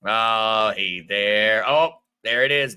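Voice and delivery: normal talking voice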